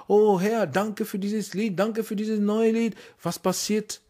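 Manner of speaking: normal talking voice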